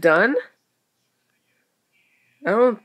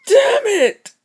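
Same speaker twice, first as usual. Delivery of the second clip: much higher, louder yell; frustrated shout; 7 dB louder than usual. Real, not acted. Gamer yelling as she falls in. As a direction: yelp it as you fall.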